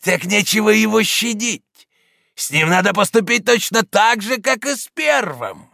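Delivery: high, loud merciless demand; cruel voice from the crowd. A narrator's reading. Someone in the mob urging the killing, cruel voice. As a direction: call for the same fate coldly. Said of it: "послышался голос".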